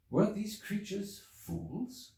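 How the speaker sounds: high, slow, disbelieving wonder; a stunned, drawn-out inner question; incredulous question. Acted. Narrator's sudden doubting inner question.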